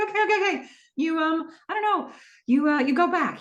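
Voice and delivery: high, laughing, flustered answer. Retold or imitated. Cam flustered answering Tom's puzzle, high and laughing. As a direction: stumble through the answer while laughing.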